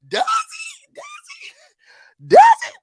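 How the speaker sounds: shrieking high and loud; shocked squeal. Real, not acted. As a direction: squeal it in disbelief.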